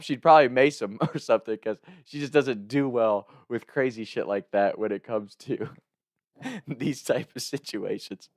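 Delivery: usual speaking voice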